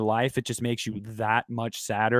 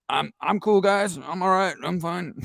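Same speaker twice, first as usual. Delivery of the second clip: high, unconvincing reassurance; mock brave face; 5 semitones higher than usual. Crying in the theater, telling the guys he's okay, mock brave. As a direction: pretend you are holding back tears. Acts out hiding tears in theater.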